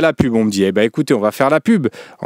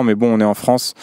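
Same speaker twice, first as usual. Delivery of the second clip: ordinary talk, nothing marked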